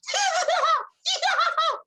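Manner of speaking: shrill giggling pixie bubbles; obnoxious high giggle. Acted. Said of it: "they kind of giggle obnoxiously and high-pitched".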